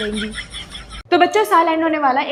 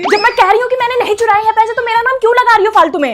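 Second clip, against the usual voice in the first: indignant denial; 6 semitones higher, 4 dB louder than usual. Acted. Daughter denying theft loudly.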